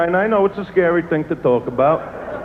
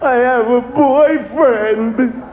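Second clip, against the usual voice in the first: higher, drawn-out coy confession; woman confessing she has a boyfriend; mock woman confessing; 6 semitones higher, slower than usual. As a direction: drop the news sweetly and slowly. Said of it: "You gotta listen".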